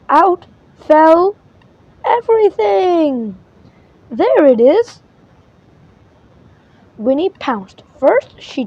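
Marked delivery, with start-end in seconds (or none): 4.1-5.0 triumphant cry, higher and louder; she's found it at last, shout it out; excited find. Acted. Winnie finds the racket.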